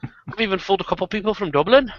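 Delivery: lilting Irish storyteller; Irish accent. Shown as a demonstration. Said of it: "you should hear my Irish accent".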